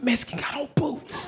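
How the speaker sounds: secretive stage whisper; retold whisper. New inmate warning a man back from work, whispered.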